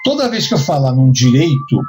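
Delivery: ordinary delivery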